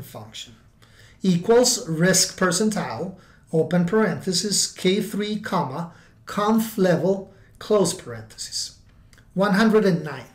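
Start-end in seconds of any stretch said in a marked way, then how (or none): 1.2-8.8 dictating. Shown as a demonstration. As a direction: spell out each part for typing. Reading out a formula.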